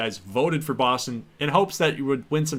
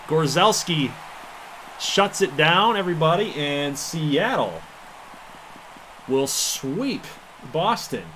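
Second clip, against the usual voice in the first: excited call; 5 semitones higher, 3 dB louder than usual. Real, not acted. Calling the final out.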